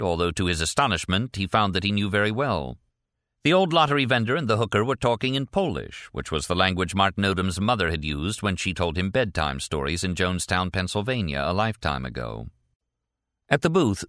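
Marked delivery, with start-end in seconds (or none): none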